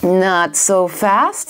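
very slow, drawn-out villain interruption; menacing villain. Acted. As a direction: stretch each word to halt the heroes.

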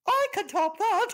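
very high squawky bird voice; grand macaw. Acted. The macaw outbids the others, very high and grand. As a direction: announce it like a showy parrot. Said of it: "announced the macaw".

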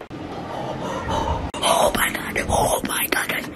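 slow, breathy whispered disbelief; panicked whisper. Acted. Vlogger sneaking round the house, panicked whisper. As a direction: gasp it out in a whisper.

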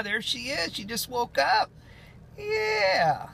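high cooing to a puppy. Real, not acted. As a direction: coo at the dog sweetly. Cooing to his waking dog.

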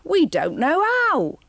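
a helpless henchman's wail; hammed-up whine. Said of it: "wailed Jasper".

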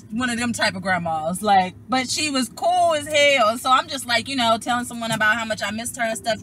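normal talking voice

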